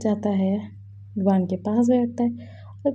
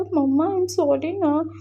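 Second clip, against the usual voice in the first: put-on pleading child; 7 semitones higher, much slower than usual. Child apologising to mother.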